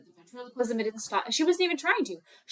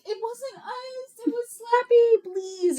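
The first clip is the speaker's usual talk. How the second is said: high, drawn-out mock whine of a child; pleading kids; 12 semitones higher, 4 dB louder, slower than usual. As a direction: plead with mom like a guilty little girl. Girls plead innocence to mom.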